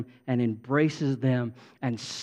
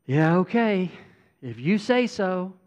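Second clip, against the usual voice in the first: imitated doubtful listeners; 5 semitones higher, 4 dB louder than usual. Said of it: "I can see it on your faces. It's like".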